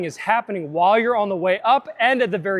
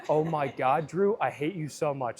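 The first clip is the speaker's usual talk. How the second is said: low, soft mock resentment; mock exasperated grumble; 6 semitones lower, 9 dB quieter than usual. To the brother who got it first try, low mock grumble. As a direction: drop low and quiet, grumbling at your brother.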